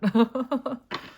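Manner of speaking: loud, high giggling burst; fail to hold the laughter in; irrepressible laugh. Real, not acted. Reader failing to stop laughing as promised, giggling loudly. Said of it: "I'm gonna stop laughing now".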